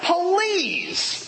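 high, drawn-out incredulous please; high-pitched "puh-lease"; exasperated please. Acted. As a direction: stretch it out as if the idea is absurd. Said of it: "We might translate that".

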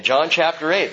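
ordinary talk, nothing marked

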